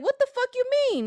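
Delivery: high, fast, indignant; mock offended retort. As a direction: snap back as if insulted.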